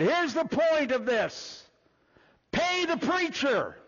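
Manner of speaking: hammed-up pulpit punchline; imitating the preacher. Preacher mimicking another preacher's emphatic pay the preacher. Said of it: "That's exactly how he said it".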